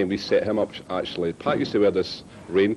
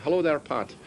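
higher-pitched mock Irish greeting; put-on Irish accent; 8 semitones higher than usual. Prank caller greeting Pat on the radio, put-on Irish accent. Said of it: "he put on this Irish accent".

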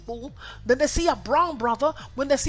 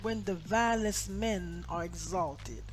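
soft, measured Bible reading; hushed, low-pitched reading from scripture; 4 semitones lower, 7 dB quieter than usual. Real, not acted. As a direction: read the verse solemnly, low and quiet. Reads the scripture verses aloud.

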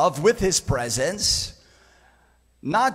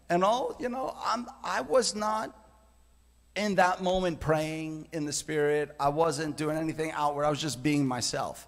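ordinary talk

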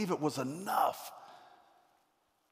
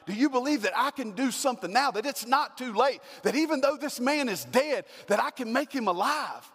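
higher, louder, pressing each question; urgent appeal; 9 semitones higher, 4 dB louder than usual. Acted. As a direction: plead that it's not too late.

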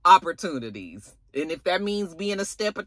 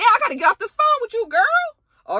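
imitating panicked Vic; 4 dB louder than usual.

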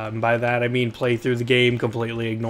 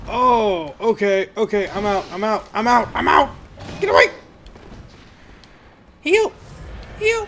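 panicked retreat; 7 semitones higher than usual. Real, not acted. Fleeing enemies, low health.

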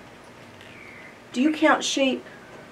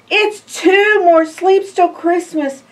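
higher, louder eager refrain; excited; 8 semitones higher, 4 dB louder than usual. Acted. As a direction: count down with delight.

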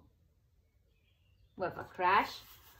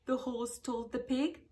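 ordinary delivery

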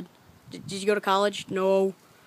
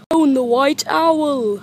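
higher, slow cast roll-call; movie credits announcer; 5 semitones higher, 5 dB louder than usual. Acted. Kid reading the cast list, announcer voice. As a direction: announce each name like closing credits.

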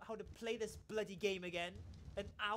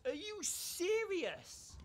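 drawn-out disbelief; 9 semitones higher, 4 dB louder, much slower than usual. Real, not acted. Disbelief at target moving away.

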